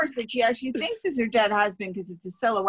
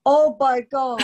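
mock shock; 4 semitones higher, 6 dB louder, slower than usual.

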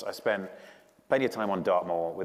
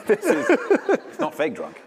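laughing; 9 dB louder, slower than usual. Real, not acted. Laughing denial after drunk joke.

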